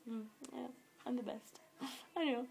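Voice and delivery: softly bragging, mock-smug; proud boast. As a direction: toss off the brag with a small laugh. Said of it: "I was really proud of myself".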